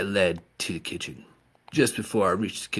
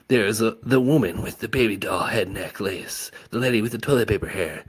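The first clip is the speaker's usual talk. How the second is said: plain reading voice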